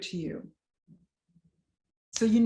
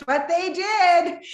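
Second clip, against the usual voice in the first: high, loud, slow angry retort; 10 semitones higher, 10 dB louder, slower than usual. Retold or imitated. Wife repeating her angry reply to the pastor's wife. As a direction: spit it out in anger. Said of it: "going to my anger place".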